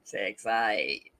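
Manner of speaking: low North Queensland bogan drawl. Shown as a demonstration. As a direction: drawl the last syllable in broad bogan Australian. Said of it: "my full North Queenslander Bogan drawl".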